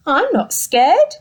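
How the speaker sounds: loud, slow defiant claim; brave little boast. Acted. Gruffalo's child insisting she's brave, loud and slow. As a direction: puff up bravely. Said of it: "said that Gruffalo child".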